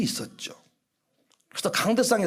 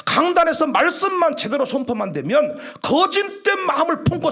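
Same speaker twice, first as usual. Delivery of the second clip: loud, high-pitched preaching at full force; fiery preaching; 7 semitones higher, 4 dB louder than usual. Real, not acted. Pastor warning that false spirits cannot bear the word, fiery preaching. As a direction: drive each sentence home to the congregation.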